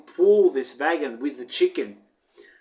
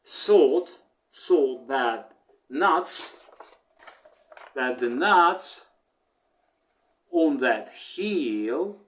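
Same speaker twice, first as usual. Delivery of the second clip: usual speaking voice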